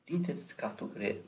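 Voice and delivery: ordinary talk, nothing marked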